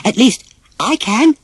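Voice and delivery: a proud little grin in the voice; Dumbo smiling. Acted. Dumbo tells the boys he can fly, high and smiling. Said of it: "said Dumbo, smiling".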